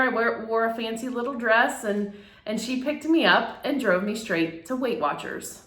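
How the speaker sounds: usual speaking voice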